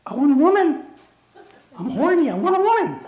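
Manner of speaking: high, loud squawking parrot voice; horny parrot. Acted. Parrot demanding a woman, high loud squawk.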